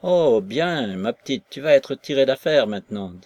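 put-on cheery old woman. Korableva cheers Maslova.

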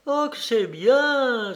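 high, slow, drawn-out cry of delight; delighted exclamation. A narrator's reading. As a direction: exclaim it with rapturous approval. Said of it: "s'écria-t-elle". (exclamation ravie et enthousiaste)

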